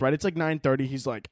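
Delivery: ordinary talk, nothing marked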